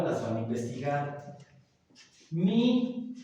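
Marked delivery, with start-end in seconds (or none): 2.3-3.1 Mi said high and bright as its own word; accented mi. Shown as a demonstration. Teacher spelling out the accented word, high. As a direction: set Mi apart, pitched up. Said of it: "pero con acento".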